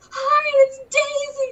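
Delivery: put-on high, loud, slow little-girl greeting; imitated excited little girl. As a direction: call out like a thrilled small child. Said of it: "the little girl would be like".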